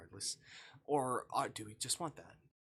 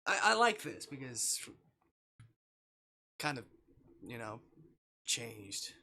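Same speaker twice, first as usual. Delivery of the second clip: emotional gush; 10 dB louder than usual. Real, not acted. Player reacting to the scene, emotional gush. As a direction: blurt it out while moved. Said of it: "I'm gonna feel emotional".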